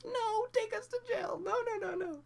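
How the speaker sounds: hammed-up wail begging for jail; imitating teens wailing and pleading; mock crying plea.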